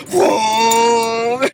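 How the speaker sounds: imitated engine rev; car vroom. Recapper imitating the husband driving off, engine noise. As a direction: make the car peel off.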